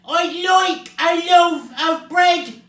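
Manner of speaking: slow, high-pitched bellow; booming shout. A narrator's reading. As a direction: bellow each word slowly across the shop. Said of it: "he boomed".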